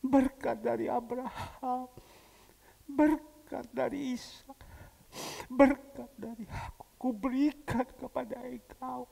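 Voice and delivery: slow, intoned father's blessing; measured, rising benediction; solemn blessing. Retold or imitated. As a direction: pronounce it like a ceremonial blessing. Blessing repeated, higher.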